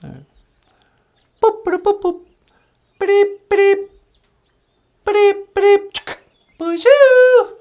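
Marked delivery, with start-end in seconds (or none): 1.4-6.0 high machine beeping; playful high beeps of a scanner; computer beeps. Acted. Robot beeps while scanning. 6.6-7.6 very high, bright greeting; a cheerful high puppet hello; [name]'s puppet voice. Acted.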